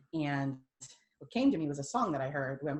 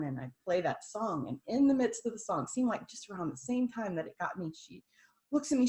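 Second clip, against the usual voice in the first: ordinary talk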